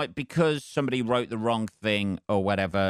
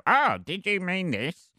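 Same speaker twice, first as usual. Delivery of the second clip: mock pedant voice; 8 semitones higher than usual. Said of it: "somebody go".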